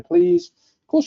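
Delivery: high, mock-incredulous "please"; playful scoff. Real, not acted. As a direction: brush off the worry with a jump up in pitch. Reassuring a worried newcomer.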